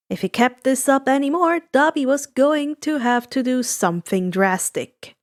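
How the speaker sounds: plain reading voice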